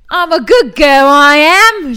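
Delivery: put-on loud, high Cockney flower girl. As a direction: belt it out in broad Cockney like Eliza. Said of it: "Give, give me a bit of Cockney".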